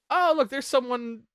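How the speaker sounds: ordinary talk, nothing marked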